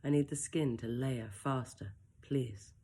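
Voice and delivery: usual speaking voice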